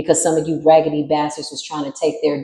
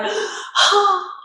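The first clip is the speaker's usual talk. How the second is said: high, breathy mock gasp of shock; exasperated gasp; 12 semitones higher, 4 dB quieter than usual. Intruder bursts into court, gasping in mock shock.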